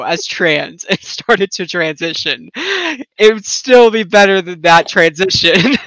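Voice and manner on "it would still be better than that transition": slow and loud, landing the joke; emphatic punchline. Real, not acted. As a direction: drag out the punchline loudly.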